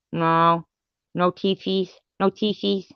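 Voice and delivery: high sing-song teasing; mocking baby talk. Teasing the rival about teeth in baby talk. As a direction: taunt like talking to a toddler.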